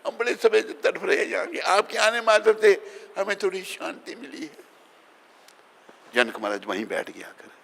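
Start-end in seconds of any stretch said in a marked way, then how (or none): 0.1-4.6 high, plaintive voice of sufferers; imitated suffering souls begging; acted pleading. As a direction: plead in a high, strained storyteller's voice. Sufferers in hell plead with king.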